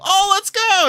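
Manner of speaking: a high, delighted whoop; excited cheer. Retold or imitated. Narrator cheering with the cashier before a high-five, whooping high. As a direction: squeal it like you just found family. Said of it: "And I go".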